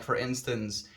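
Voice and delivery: ordinary talk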